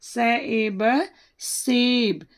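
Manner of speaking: very slow: स ए ब, one sound at a time; sounding out letters. Shown as a demonstration. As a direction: sound out स ए ब slowly. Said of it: "ए की मात्रा".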